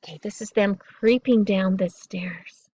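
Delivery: ordinary delivery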